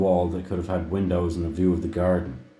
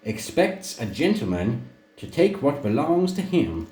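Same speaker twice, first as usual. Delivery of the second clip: high, drawn-out lady's voice, every syllable savoured; lounging grande dame coaxing him; languid drawl; 8 semitones higher than usual. A narrator's reading. Serena exhorting Luther from bed, languid drawl. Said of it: "she would say in her most languid, multisyllabic drawl".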